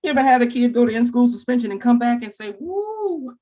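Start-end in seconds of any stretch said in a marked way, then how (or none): 2.6-3.3 high drawn-out "woo"; mock revelation whoop. Kid back from suspension, high mock-converted whoop. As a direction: whoop like you just saw the light. Acting reformed pupil.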